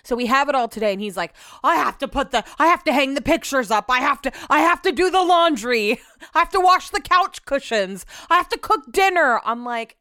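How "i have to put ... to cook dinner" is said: mock frantic listing, higher and climbing; high-strung husband. Wife imitating husband listing his chores, high-strung and rising. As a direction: get more worked up with each chore, mimicking him. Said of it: "like, a high-strung individual".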